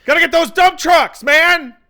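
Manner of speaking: loud, high-pitched mock excitement; whooping fanboy enthusiasm; hyped movie fan. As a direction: yell it like a thrilled viewer. Acting a thrilled movie viewer.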